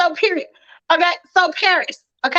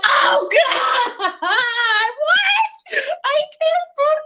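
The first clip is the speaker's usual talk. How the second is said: high, half-laughing pretend wail at a funeral; hammed-up grief cry, voice shooting up; mock breakdown wailing; 10 semitones higher, much slower than usual. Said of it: "I'ma be like".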